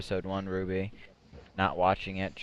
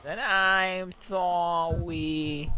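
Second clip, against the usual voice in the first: cutesy voice; 6 semitones higher, much slower than usual. Pretend. Voicing a cat apology.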